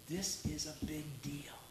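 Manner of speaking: slow, whispered emphasis; slow, grave whisper to the congregation; hushed whisper. Acted. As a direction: drop to a whisper to make it land. Pastor stresses weight of supper.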